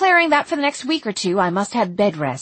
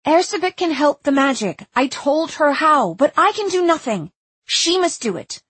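raised, emphatic simple sentences; urgent, bumbling plea; 6 semitones higher, 4 dB louder than usual. A narrator's reading. Narrator pleads with the mother in clumsy Magyar, urgent and emphatic. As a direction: insist word by word, voice pitched up. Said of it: "in bumbling Magyar".

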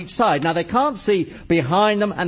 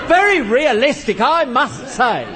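heavy sarcasm; 6 semitones higher than usual. Acted. Game explainer finishing his chess tirade, sarcastic. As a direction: cap the rant scornfully, slowing at the end.

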